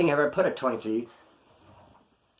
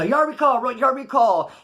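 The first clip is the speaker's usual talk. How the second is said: high, raised yard-call voice; 9 semitones higher, 4 dB louder, slower than usual. Retold or imitated. Storyteller imitates the prison yard recall, calling out high.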